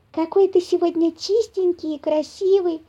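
put-on high, admiring girlish voice; little squirrel girl. A narrator's reading. Squirrel girl noticing her friend is clean, admiring. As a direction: admire him in a small high voice. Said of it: "заметила Лися".

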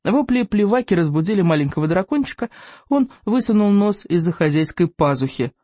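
ordinary delivery

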